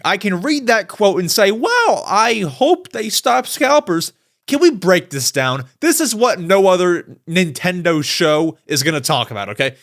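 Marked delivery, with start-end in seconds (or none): 1.5-4.1 high, drawn-out mock amazement; mock bland reaction. Host imitates a boring reaction to the quote, mock wonder. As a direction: pretend to be politely impressed, stretching it out. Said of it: "I can be boring".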